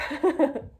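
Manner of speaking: a little burst of amused laughter; short laugh. Real, not acted. Amused at her own "not deep.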